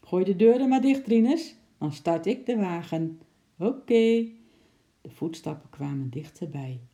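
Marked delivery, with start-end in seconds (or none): 0.0-4.3 louder, higher call, lightly voiced mover; mover's voice. Mover to Rinus, about to shut the doors, calling out. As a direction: call out like a workman outside the van. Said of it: "vreemde, zware stemmen".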